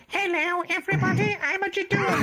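very high, squeaky comic monster; silly Judoon voice. Shown as a demonstration. Hulking monster comes on and greets everyone, absurdly squeaky voice. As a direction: squeal it in a tiny falsetto. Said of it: "enormous hulking monster come on and go".

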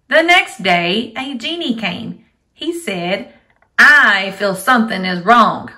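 loud, lively storybook reading; reading to a toddler. Acted. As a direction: read aloud to small children. Teacher reads story to kids.

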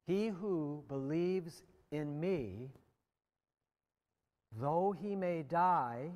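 each phrase drawn out slowly; very slow, careful scripture reading. Shown as a demonstration. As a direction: read it slowly, weighing every word. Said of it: "I'm gonna read these very slowly and very carefully".

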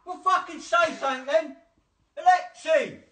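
man shouting the wrong name at the speaker; angry shout. Real, not acted. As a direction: demand an answer. Yelling at smart speaker.

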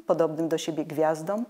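usual speaking voice

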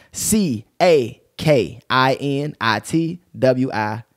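very slow, separated letters; spelling out. Shown as a demonstration. Host respelling the handle for followers, slow spelling. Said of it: "Let me spell that again".

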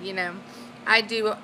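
ordinary delivery

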